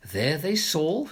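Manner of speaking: ordinary delivery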